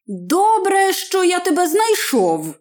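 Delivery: high-pitched glad exclamation; gladdened. A narrator's reading. Man finds the missing hobbit, glad high exclamation. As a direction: lift the voice high with relief. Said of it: "зрадів чоловік".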